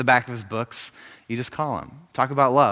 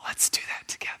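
hushed, voiceless whisper; 10 dB quieter, much faster than usual. Acted. God invites the listener in, whispered in the ear. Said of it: "whisper in our ear and say".

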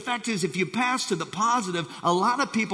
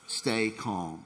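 calm low; 9 semitones lower, 8 dB quieter than usual. Shown as a demonstration. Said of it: "Everybody just shout that with me".

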